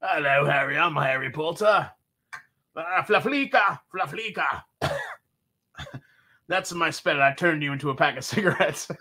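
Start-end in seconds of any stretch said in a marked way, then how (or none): 0.0-7.9 smoker wizard with a mock English accent; hammed-up English accent, casting a silly spell; adult Harry Potter. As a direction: play a five-pack-a-day wizard, English accent. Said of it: "adult Harry Potter that's been smoking".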